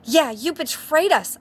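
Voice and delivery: high, loud accusing shout; hammed-up betrayed rage; angry shout. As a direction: yell the betrayal at him. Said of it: "shooted Vampire angrily".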